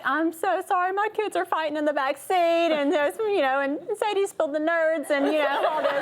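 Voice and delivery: high, tearful, half-laughing apology; retold bawling. Mom apologizing to the policeman, a mock tearful wail. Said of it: "And then I just start bawling".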